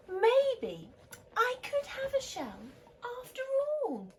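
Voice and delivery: high, bright-eyed hopeful voice; dawning idea, said high. Acted. Norman hatching a plan, high hopeful wondering. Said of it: "he thought".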